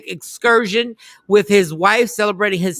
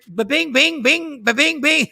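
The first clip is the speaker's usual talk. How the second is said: phone bings mimicked; 7 semitones higher than usual. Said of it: "Listen, I don't know what they're".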